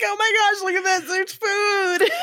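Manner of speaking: high, loud mock delight; mock overjoyed. At the Whole Foods salad bar, high hammed-up delight. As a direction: gush over it like a miracle.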